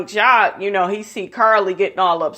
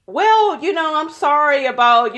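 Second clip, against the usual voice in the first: much higher, put-on apologetic voice; fake sorry; 7 semitones higher than usual. Messy castmate apologising to the group, high and fake-sweet. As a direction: lay on a mock sweet apology. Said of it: "And she's just like".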